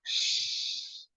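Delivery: one long, soft voiceless shush; drawn-out, quiet "shhh"; hushing shh. Acted. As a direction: hush someone making noise. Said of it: "everyone say".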